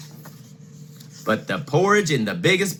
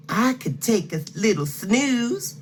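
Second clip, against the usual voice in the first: Goldilocks voice; 9 semitones higher than usual. Acted.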